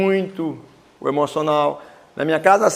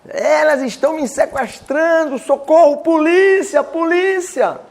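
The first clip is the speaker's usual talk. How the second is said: panicked cry for help; 9 semitones higher, slower than usual. Retold or imitated. Speaker echoing the old woman's cries for police, mock panic. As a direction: mimic the old woman's alarm, pitched up high.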